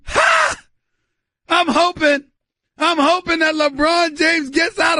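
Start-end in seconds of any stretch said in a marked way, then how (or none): 0.1-0.6 whoop. Real, not acted. Burst after cheering.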